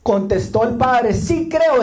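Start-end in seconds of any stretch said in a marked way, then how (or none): 1.1-1.8 father's quick, high cry of faith; a desperate father crying out that he believes; urgent exclamation. Acted. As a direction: blurt out your belief. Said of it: "exclamó de inmediato el padre".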